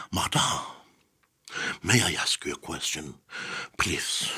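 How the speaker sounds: overly formal journalist, very hushed and measured; stiff journalist formality. Shown as a demonstration. Formal journalist approaching an interviewee, stiff and hushed. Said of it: "people don't talk like that".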